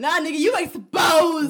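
loud shout, laughing. Real, not acted. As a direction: call him out loudly. Calling out friend.

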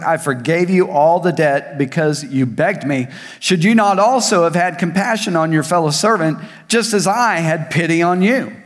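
preacher reading the Bible; scripture reading. Acted. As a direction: proclaim the parable to the church.

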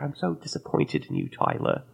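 mock British teacher, low and stern; a hint of a disappointed British teacher. Preschool teacher scolding a boy, British accent. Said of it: "she was a British lady".

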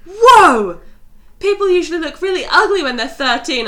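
loud, high shocked whoa; shocked guy. Retold or imitated. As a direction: gasp at the yearbook photo. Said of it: "And he's like".

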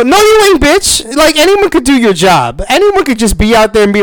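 high, loud furious yelling; angry rant. Real, not acted. Ranting at the TV host.

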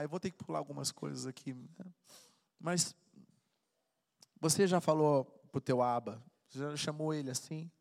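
ordinary talk

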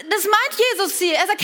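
ordinary delivery

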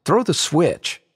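high-pitched eager order; eager exclamation. A narrator's reading. Marley eager to be teleported, excited exclamation. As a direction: urge them on, pitch lifted. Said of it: "Marley exclaimed".

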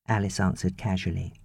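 ordinary delivery, nothing marked

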